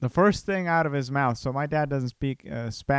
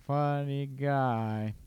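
slow drawn-out echo; 4 dB quieter, much slower than usual. Real, not acted. Podcaster fondly repeating his remark about his dad, slowly drawn out. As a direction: stretch both words out slowly.

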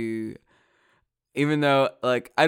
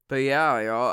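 normal talking voice

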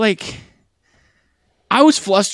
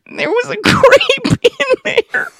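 much higher, laughing through it, slow; laughing disbelief; 8 dB louder, slower than usual. Real, not acted. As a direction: crack up in disbelief at the grape. Shocked at grape in salad.

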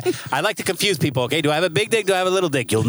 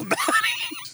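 laughing gush; 4 dB quieter than usual. Real, not acted. Teasing about his crush.

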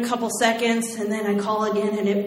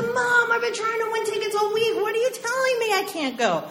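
much higher, outraged whine; indignant teen; 13 semitones higher than usual. Retold or imitated. Teen protesting to mom about concert ban, indignant.

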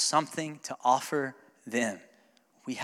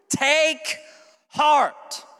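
shouted command; 11 semitones higher, 7 dB louder than usual. Acted. Pastor shouting the words to his church, high and loud. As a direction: TAKE HEART called out high and loud. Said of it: "he gives you an action to take".

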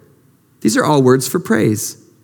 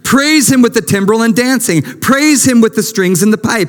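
raised, ringing scripture reading; psalm reading; 6 semitones higher than usual. Acted. Preacher reading Psalm 150 to the congregation, high and ringing. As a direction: proclaim each line of praise.

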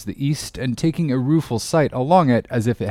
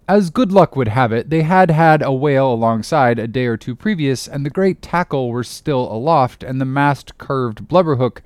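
ordinary delivery

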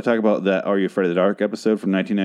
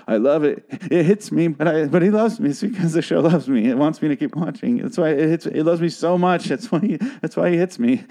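mock abused partner; 6 semitones higher than usual. Parodies abused partner's excuses.

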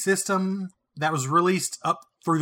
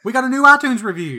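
excited; 8 semitones higher, 7 dB louder than usual. Real, not acted. Announcing a new review excitedly.

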